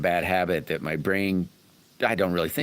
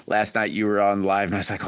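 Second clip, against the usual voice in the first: usual speaking voice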